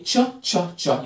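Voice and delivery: "تشا" repeated as short separate "cha" sounds; "تشا" in separate beats; letter-sound demo. Shown as a demonstration. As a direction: punch out each "تشا" as one syllable. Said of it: "بنطقها إيه".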